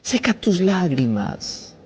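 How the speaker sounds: Seca tus lágrimas drawn out slowly, every word stretched; a slow, soothing command; slow and tender. Acted. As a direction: lay each word down gently. Comforting the listener mid-sermon.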